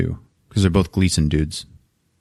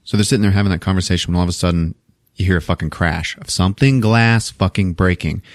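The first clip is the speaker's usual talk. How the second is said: usual speaking voice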